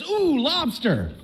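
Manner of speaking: drawn-out high ooh at the food; mock delighted ooh. Wife on seeing the lobster, a slow high delighted ooh. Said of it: "and she says".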